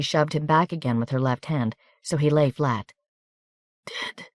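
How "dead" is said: a barely voiced, much softer mutter; dying soldier muttering that he is done for. A narrator's reading. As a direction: give up, almost inaudibly. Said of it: "he muttered".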